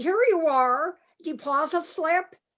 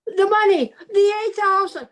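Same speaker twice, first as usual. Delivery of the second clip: loud tearful cry; crying panic; 4 semitones higher, 5 dB louder than usual. Acted. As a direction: blurt it out sobbing in alarm. Billy crying over lost money.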